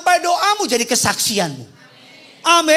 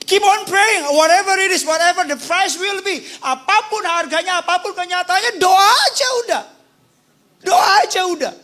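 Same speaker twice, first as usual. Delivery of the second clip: fervent preaching shout; 8 semitones higher than usual. Real, not acted. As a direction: rise up and urge them to keep praying. Preacher's climax call.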